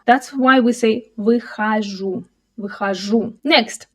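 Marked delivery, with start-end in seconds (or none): 1.2-3.3 "выхожу" with unstressed O as A, vykhazhu; reduced O. Shown as a demonstration. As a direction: turn the O in "выхожу" into A. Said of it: "all unstressed O in words are pronounced as A".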